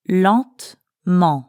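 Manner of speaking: Lentement said slowly with both nasal vowels drawn out; slow nasal demo. Shown as a demonstration. Teacher shows learners the nasal vowels, slowly. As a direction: stretch Lentement to show its nasal sounds. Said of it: "Do you hear the two nasal sounds?".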